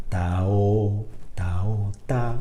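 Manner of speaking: "ta" then a bare "o", consonant only on the first note; vowel-led legato. Shown as a demonstration. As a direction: sing the vowel on and drop the consonant. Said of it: "solo pienso en la vocal de la nota".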